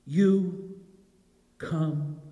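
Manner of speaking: spaced, hushed but firm; quiet command. Acted. Jesus commands him down.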